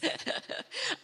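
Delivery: high-pitched giggle at one's own stumble; embarrassed laugh. Real, not acted. Laughing off her botched name.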